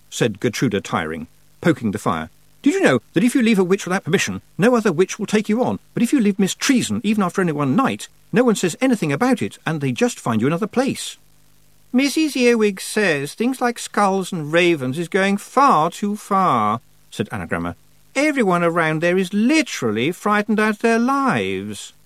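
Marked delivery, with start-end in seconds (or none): 2.6-11.2 put-on higher, fast gossiping girl; quick, knowing young witch; Gertruda voiced. 11.9-21.9 put-on higher, haughty girl's voice; prim, self-important girl; Anagramma voiced. Anagramma's pronouncement.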